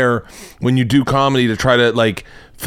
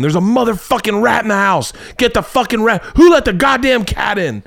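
high, frantic mock rant at the family; hammed-up panic over a rat in the house; mock angry yelling; 11 semitones higher than usual. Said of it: "it's me going".

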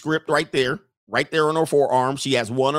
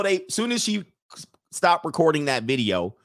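ordinary delivery

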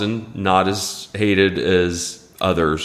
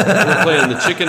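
laughing; 8 semitones higher, 5 dB louder, faster than usual. Real, not acted. Laughing through the line.

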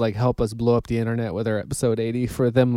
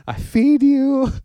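high, slow scolding of a dog; 13 semitones higher, 4 dB louder, much slower than usual. Retold or imitated. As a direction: tell the dog off, slowly and high. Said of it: "all I remember hearing though was like".

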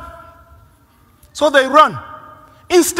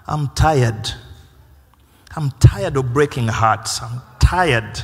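weary confession; 7 semitones lower, 3 dB quieter than usual. Retold or imitated. Preacher voices a weary sinner.

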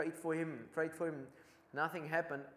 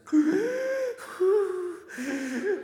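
a high, loud startled gasp; sharp gasp; 8 dB louder than usual. Retold or imitated. Boy feeling touched during prayer, loud startled gasp. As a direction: gasp in sudden awe, drawing breath in hard. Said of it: "the boy went like this".

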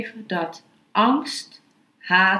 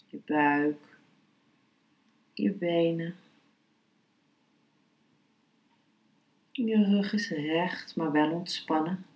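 guided meditation; 3 dB quieter than usual. Acted. (rustige, zachte meditatiestem)

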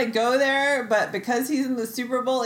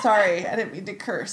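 ordinary talk, nothing marked

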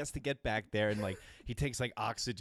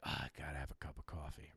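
groggy mumble; 7 semitones lower, 9 dB quieter than usual. Retold or imitated. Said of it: "I'm like".

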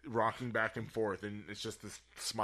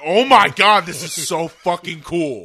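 loud thrilled outburst, much higher; excited shout; 8 semitones higher, 18 dB louder than usual. Retold or imitated. Fan reliving the movie scene, shouting with glee. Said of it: "You're like".

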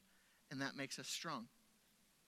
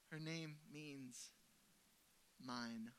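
slow, soft, tender; slow hushed reveal; 2 semitones lower, 9 dB quieter, much slower than usual. Retold or imitated. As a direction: let the word land gently. Tender revelation of the name.

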